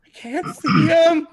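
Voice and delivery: high, loud wailing cry; terribly upset creature. Acted. Upset foe who has lost sight of the bard, wailing shout. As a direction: shout it like a thwarted, desperate fan. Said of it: "terribly upset that you've gotten in the way of his view".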